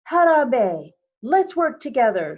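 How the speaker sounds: loud, rousing call to gather the women; rallying call. Acted. Wangari rallying her countrywomen to plant trees, loud rousing call. Calling countrywomen to work together.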